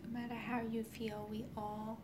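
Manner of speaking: soft whispered meditation voice; right in your ear. Acted. Leads a guided healing meditation.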